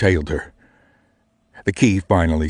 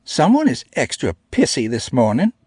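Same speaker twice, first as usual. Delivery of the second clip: put-on higher, huffy girl voice; 7 semitones higher than usual. A narrator's reading. Namie huffing at the grumpy narrator, higher and miffed. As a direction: huff it out, put out. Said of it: "Namie huffed".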